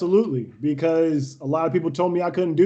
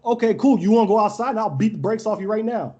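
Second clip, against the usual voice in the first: raised-pitch, cocky threat; retold challenge to fight; 6 semitones higher than usual. Player invites the kid outside to fight, retold cocky challenge. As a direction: call his bluff in a retold quarrel.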